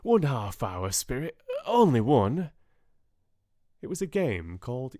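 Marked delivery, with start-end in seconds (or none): 0.0-2.5 put-on louder, rising plea like a begging boy; loud, higher pleading old man; Scrooge pleading. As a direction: beg to stay just a little longer. Scrooge begging the ghost to stay.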